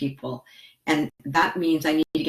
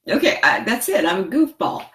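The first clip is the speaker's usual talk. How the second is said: giddy outburst; 4 semitones higher, 6 dB louder than usual. Real, not acted. Laughing at being called real.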